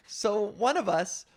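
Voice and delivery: ordinary talk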